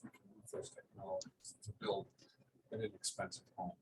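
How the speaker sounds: real soft, faint speech; too quiet to hear. Real, not acted. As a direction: let the volume sink until listeners struggle to hear. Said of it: "can you speak up a bit more".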